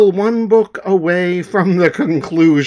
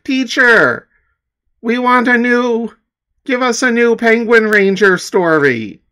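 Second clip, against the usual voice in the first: put-on high, louder begging kid voice; eager students; 9 semitones higher, 4 dB louder than usual. Teacher imitates students demanding a new story, high and eager. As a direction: whine for the next story like a student. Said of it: "the students would be asking".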